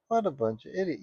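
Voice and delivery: Bugs Bunny. Retold or imitated. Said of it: "I'm just Bugs Bunny standing there saying".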